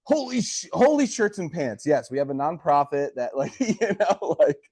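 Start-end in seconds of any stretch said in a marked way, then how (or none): 0.0-1.8 much higher, faked shock that swerves from a curse; mock shocked exclamation. Wedding Crashers persona catching a near-swear, mock shocked and much higher. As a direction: gasp it out like a startled do-gooder. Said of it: "Or, or".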